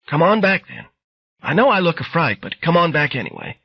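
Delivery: put-on old woman calling a visitor back to her bedroom, raspy. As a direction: call out like a frail old lady from her bed. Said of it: "the raspy voice of an old woman".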